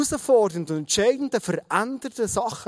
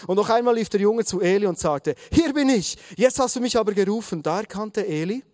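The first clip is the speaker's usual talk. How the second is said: lively, raised storytelling read-aloud; animated Bible narration; 3 semitones higher than usual. A narrator's reading. Preacher reading of Samuel's third calling, animated narration. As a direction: read the story out with rising drama.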